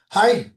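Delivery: "Hi" said short, high and loud; "Hi" demonstrated as a sharp Japanese yes; Japanese hai demo. Shown as a demonstration. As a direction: bark out "Hi" crisply as the Japanese yes. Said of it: "you say it like that".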